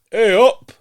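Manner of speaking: loud, high, hammed-up Northern English accent; exaggerated Northern English podcast greeting, drawn out. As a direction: greet the listeners in broad mock Northern. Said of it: "you might lose your accent".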